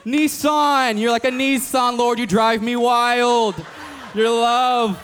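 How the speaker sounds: high, loud, slow, gushing mock prayer; over-the-top prayer. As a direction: ham it up like a panicked prayer warrior. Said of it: "you just start talking crazy".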